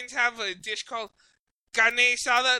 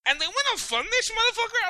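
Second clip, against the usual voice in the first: mock disbelief; 8 semitones higher than usual. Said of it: "I'm like".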